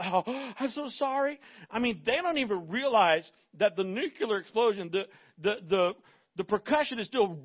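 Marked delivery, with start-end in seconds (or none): 0.0-1.4 mock apology. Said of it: "they wanna apologize right away".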